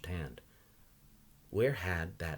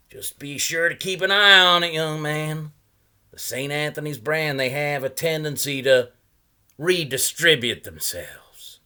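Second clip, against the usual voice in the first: put-on a higher, louder voice for the odd old salesman; old man pitching his wares, higher and louder; 9 semitones higher, 12 dB louder than usual. A narrator's reading. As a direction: play the chatty old knot peddler. Salesman's voice in read story.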